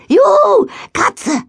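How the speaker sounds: high excited whoop; joyful cry. A narrator's reading. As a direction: squeal with delight. Joy after transforming.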